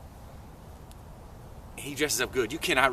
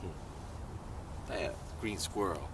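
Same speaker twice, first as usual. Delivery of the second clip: low, hushed musing; thinking to oneself; 8 semitones lower, 7 dB quieter than usual. Acted. Toad doubting the squirrel to himself, low musing. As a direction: mull it over privately, soft and low.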